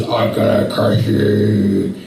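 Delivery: very slow, low menacing giant; Goliath threatening David, slow and deep. Retold or imitated. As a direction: drag it out like a giant. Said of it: "Goliath's like".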